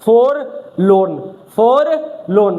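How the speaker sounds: "फॉरलोन" very slowly, in two drawn-out halves; "फॉरलोन" slowly broken up for the class; spelled-out reading. Shown as a demonstration. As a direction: stretch "फॉरलोन" as for... lorn, sounding the r. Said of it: "क्या पढ़ने में आएगा".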